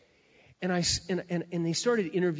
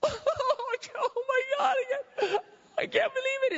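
shrieking sob, much higher and slow; hammed-up crying girl. The girl seeing her mirror, shrill crying squeal.

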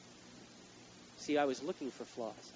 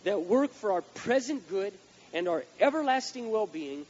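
loud, slow, higher preaching; full-force preaching; 6 semitones higher, 8 dB louder, slower than usual. Acted. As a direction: drive each word home.

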